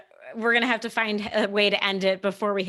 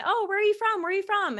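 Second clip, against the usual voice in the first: chat room voices; 11 semitones higher, faster than usual. Retold or imitated. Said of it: "everyone was chiming in".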